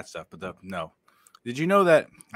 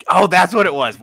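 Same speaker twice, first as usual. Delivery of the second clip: loud, high burst of recognition; suddenly remembering why he saved it, loud and high; sudden realization; 8 semitones higher, 11 dB louder than usual. Real, not acted. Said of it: "sudden realization".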